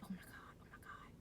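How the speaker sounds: whispered oh my God, worried; anxious whisper. Retold or imitated. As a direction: breathe it under your breath. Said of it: "So I was right there like".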